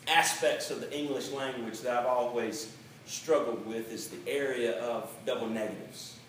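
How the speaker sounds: ordinary talk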